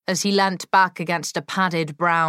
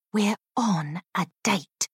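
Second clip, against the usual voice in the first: through clenched teeth; 3 semitones higher, 4 dB quieter than usual. Acted. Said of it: "Molly muttered through clenched teeth".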